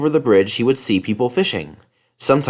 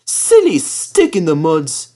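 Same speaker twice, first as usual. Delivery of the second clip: higher, slow scornful snort; snorting impatiently; 6 semitones higher, 3 dB louder, slower than usual. Acted. Thomas scoffs at the other engines, slow snort. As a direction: snort it dismissively. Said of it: "he would snort impatiently".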